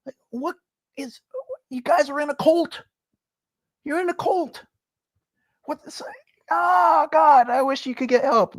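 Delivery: way up high, squeaky disbelief; shrill exasperated plea to fans in a cult; incredulous. Real, not acted. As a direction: react like you can't believe it. Exasperated rant at LeBron fans.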